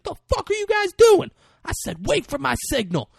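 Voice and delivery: very high, loud furious shout; shrill angry mock yell. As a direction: scream at the soldiers. Chris Pratt yelling at soldiers.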